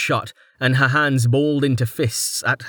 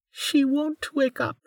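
put-on high, slow, soft sobbing child; tearful child; 5 dB quieter, slower than usual. A narrator's reading. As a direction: speak through a fearful sob. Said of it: "a sob breaking through her fear".

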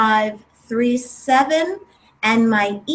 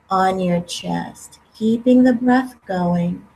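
guided meditation; 3 semitones lower than usual. Acted. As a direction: calmly guide them, unhurried. Leads a guided breathing meditation.

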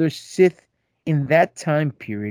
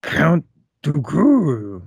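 slow, put-on creature voice; Yoda; much slower than usual. Host reading a fan's email, slow Yoda impression. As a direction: draw out the name in a character impression. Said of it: "in my best Yoda voice".

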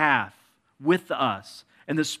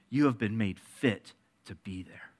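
hushed whisper; 6 semitones lower, 6 dB quieter than usual. Acted. Pastor assures congregation they belong.

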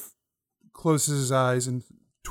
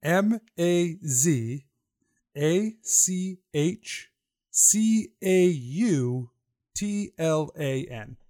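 slow letter-by-letter, a bit higher; spelling it out; 4 semitones higher, 3 dB louder, much slower than usual. Shown as a demonstration. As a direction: spell each letter out slowly. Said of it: "can you spell that for me".

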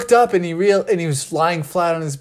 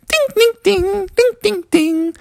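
very high little bell noises; tinkling fairy dings. Acted. Tinkerbell nodding to Froggy instead of talking, high tinkling dings. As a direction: chime like a tiny fairy bell. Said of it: "was like".

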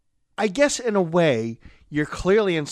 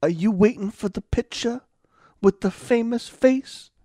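higher, halting New York pitch; Andrew Dice Clay; 5 semitones higher than usual. Acted. Comic approaching random people in New York, mock tough-guy voice. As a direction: accost strangers as a cocky celebrity. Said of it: "and he goes".

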